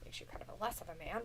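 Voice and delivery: fast teasing whisper; quick whisper. Real, not acted. Host whispers a teasing jab at the co-host. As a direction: toss it off in a rushed whisper. Teasing aside during trivia.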